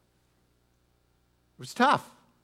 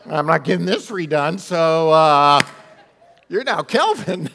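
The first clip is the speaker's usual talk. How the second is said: louder quote broken up by laughter; laughing mom; 9 dB louder than usual. Retold or imitated. Pastor retelling the tattooed mom telling her son he is now Kelvin, laughing. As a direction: giggle through the defiant mom's words. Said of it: "legally had her son's name changed".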